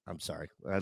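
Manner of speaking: real choked-up murmur, very low and soft; close to crying. Real, not acted. Host moved remembering Pam's wedding, close to crying. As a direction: drop to a hushed, emotional apology. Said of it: "Oh, he's gonna cry".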